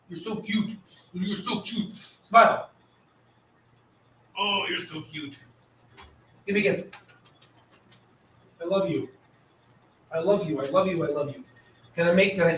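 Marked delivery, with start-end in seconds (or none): 0.1-6.8 soft, tender pet talk; doting coo. Real, not acted. Owner praising his dog live, soft doting coo. 8.6-11.4 tender pet talk. Real, not acted. Talking to his dog.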